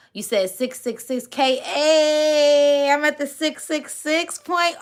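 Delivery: high, loud excited squeal; thrilled at the milestone, voice cracking; tearful excitement. Real, not acted. Excited reading of 666K.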